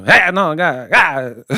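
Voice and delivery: startling bursts in a mock accent; imitated accent, inflected as if to scare; Triple G. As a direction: jump out at them with a foreign-accented exclamation. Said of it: "inflect his voice like he trying to scare you".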